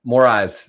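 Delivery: self-corrected "Moraes", rhyming its end with "rise"; corrected pronunciation. Real, not acted. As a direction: say "Moraes" ending like "eyes". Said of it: "it's actually pronounced Moraes".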